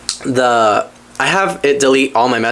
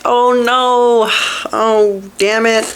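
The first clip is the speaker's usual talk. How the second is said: higher, slow put-on dismay; mock disappointment; 8 semitones higher, much slower than usual. Retelling finding out they missed the house, mock dismay. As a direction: draw out the letdown, half-joking. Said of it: "I was like".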